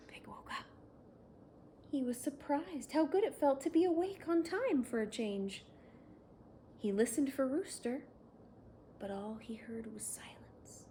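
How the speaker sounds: ordinary delivery, nothing marked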